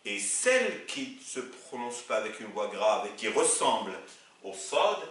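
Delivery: ordinary talk, nothing marked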